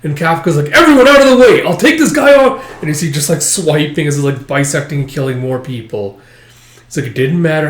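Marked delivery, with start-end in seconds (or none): 0.7-2.6 much higher and louder defiant yell; heroic battle shout. Acted. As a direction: shout everyone clear and charge. Kafka charges the kaiju.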